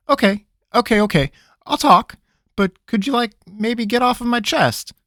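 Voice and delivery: a cornered trickster pleading; nervous country rogue. Acted. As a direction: squirm and stall, talking fast. Voices the nervous Pickett.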